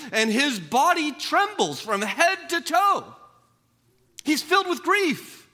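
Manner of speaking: high, loud, driving sermon delivery; preaching at full force. Acted. Preacher stirring the congregation, high and loud. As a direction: pour it on the congregation, rising to a peak. Preacher builds on Habakkuk's fear.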